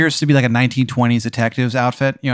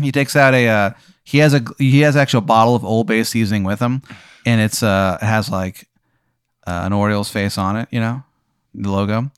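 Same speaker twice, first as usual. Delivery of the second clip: ordinary talk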